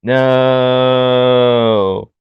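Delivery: loud, long monotone no; mock wail without any inflection; Roddy. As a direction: hold it on one note. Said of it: "it's all monotone 'cause it's Roddy".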